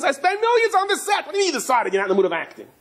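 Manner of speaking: very high, fast outburst; exasperated director. Acted. Director scolding the actor who won't act, high and fast. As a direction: rant at the lazy actor. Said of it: "the director comes".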